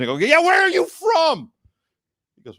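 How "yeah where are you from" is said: much higher, louder, slow yell; hammed-up angry shout; mock furious rant. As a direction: yell it like an outraged passenger. Ranting at the driver.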